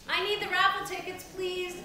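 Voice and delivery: high, loud call across the room; yelling across the room. Real, not acted. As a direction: call out so the back of the room hears. Repeats the request across the room.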